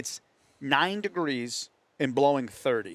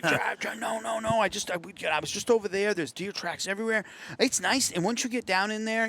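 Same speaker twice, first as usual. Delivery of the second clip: excited; 8 semitones higher than usual. Retold or imitated. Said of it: "and he's all excited".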